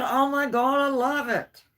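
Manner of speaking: high, loud delighted cry; husband overjoyed by the huge potatoes; thrilled husband. Retold or imitated. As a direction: gush over it like an overjoyed husband.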